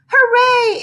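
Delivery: high, loud puppet cheer; exclaiming hooray. A narrator's reading. Punch the puppet cheering at being saved, high and loud. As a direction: cheer with relief. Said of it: "exclaimed Punch".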